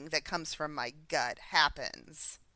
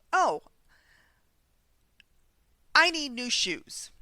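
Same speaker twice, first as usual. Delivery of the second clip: impulsive want; 7 semitones higher, 5 dB louder than usual. Retold or imitated. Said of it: "that's a first thought".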